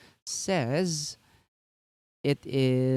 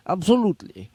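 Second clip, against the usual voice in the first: put-on heavy Russian accent, higher and louder; 6 semitones higher, 7 dB louder than usual.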